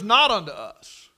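preacher lifting the verse; emphatic scripture quote. Real, not acted. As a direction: proclaim the verse.

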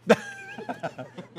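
burst of laughter. Real, not acted. Host laughs at Getty joke.

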